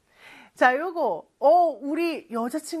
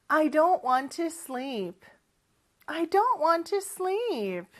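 slow practice model; 5 semitones higher, much slower than usual. Shown as a demonstration. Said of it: "다시 한번 해볼까요".

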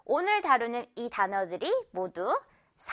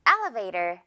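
"elevator" with a punched EL and low tail; first-syllable stress; 5 semitones lower, much slower than usual. Shown as a demonstration. Teacher models the example sentence, first-syllable stress. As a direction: lean on EL in "elevator", let the rest fall. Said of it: "L 부분에 강하게".

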